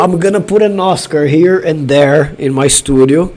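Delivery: ordinary talk, nothing marked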